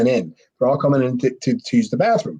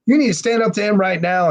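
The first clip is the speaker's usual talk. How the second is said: higher-pitched imitation of guys egging someone on; retold needling, pitched up; goading; 8 semitones higher than usual. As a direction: goad him like the crowd. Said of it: "they're goating him and goating him".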